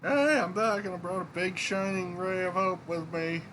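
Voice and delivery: upbeat game character. Acted. Innkeeper greets colleagues with a hopeful newcomer, high upbeat character voice. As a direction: voice a character arriving with news.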